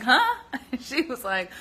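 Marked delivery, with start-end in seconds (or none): 0.0-0.4 sudden high, loud confused huh; baffled huh. Retold or imitated. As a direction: react like the question made no sense. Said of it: "I was like".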